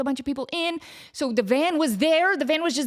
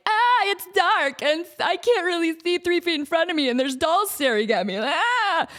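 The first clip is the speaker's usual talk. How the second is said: higher, hammed-up scared voice; mock scared inner voice; 6 semitones higher than usual. Imitating her own fear inside the dark tunnel. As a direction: play at being spooked in the dark.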